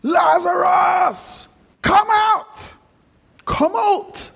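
high, drawn-out summoning cry; loud cry. Acted. Preacher as Jesus calling Lazarus from the tomb, loud cry. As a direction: call the dead man out of the tomb, high and ringing. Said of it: "he cries with a loud voice".

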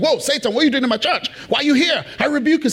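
a hint of a shocked pastor rebuking; startled pastor. Pastor finding Satan in his church, mildly startled. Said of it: "He was like".

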